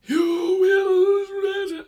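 loud, high, drawn-out elf queen; hammed-up elf queen proclamation; Cate Blanchett impression. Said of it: "it looks like Cate Blanchett is up there, like".

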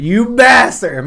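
high, loud, drawn-out curse; mock outraged yell. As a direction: hurl it slowly at the villain. Cursing film villain, echoing cohost.

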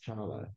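"Chala" repeated with the T left silent; name pronunciation. Shown as a demonstration. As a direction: say "Chala" plainly, cha-la, no T. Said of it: "You don't pronounce the T".

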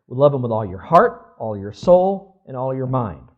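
booming proclamation. Acted. Preacher booming the commandment.

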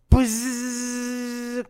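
long, high imitated buzz; spaceship buzz. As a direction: hold a steady high-pitched buzz.

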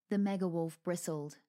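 usual speaking voice

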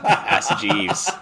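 high, loud laughing quip. Real, not acted. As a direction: laugh it out as a joke. Joking about old search engine.

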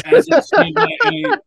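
high, loud burst of excitement; excited squeal. Real, not acted. To his friend about the soggy fries, an excited high squeal.

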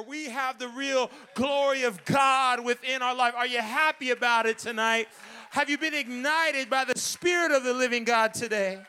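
loud fired-up preaching; preaching at full force. Acted. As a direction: rouse the congregation with a shout.

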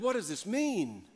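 high, drawn-out puzzled question; acted perplexity. Preacher quoting the perplexed crowd, acted bewilderment. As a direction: play a baffled onlooker, slowly and high. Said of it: "They were all amazed and perplexed".

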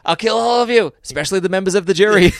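high, loud furious screaming; berserk rage. Retold or imitated. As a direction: lose it and scream at the jury. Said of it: "Wow, that went badly".

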